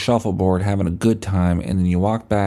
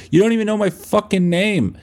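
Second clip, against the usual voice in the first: mock retort; 13 semitones higher than usual. Guy retorts to bouncer.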